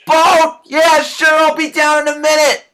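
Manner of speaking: loud, high, called from far away; from off stage. Acted. Brittany answering from off stage, shouting. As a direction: answer from another room. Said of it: "From off stage".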